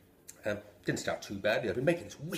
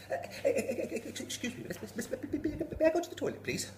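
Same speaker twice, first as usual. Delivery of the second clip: put-on high stammering little boy; desperate child. Four-year-old begging teacher for the toilet, desperate. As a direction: beg in a frantic rush.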